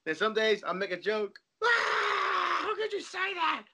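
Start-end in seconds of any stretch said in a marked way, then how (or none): 1.6-3.6 high-pitched mock wail of hurt; oversensitive wife. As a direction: imitate an upset wife, way up high. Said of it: "She's in that oversensitive stage".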